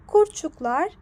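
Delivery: ordinary delivery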